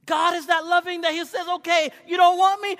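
loud, high preacher's cry; fiery preaching. Acted. Preacher crying out to the church, loud and high. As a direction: preach it hard to the congregation.

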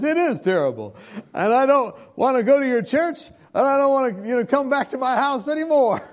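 loud complaint. Retold or imitated. Frightened man tells his pastor to stay away, loud and high. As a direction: protest it with everything.

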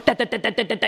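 rapid staccato gun noise; imitated gunfire. Preacher imitates a shooter's gunfire in rapid mock bursts. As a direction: rattle it off like a machine gun.

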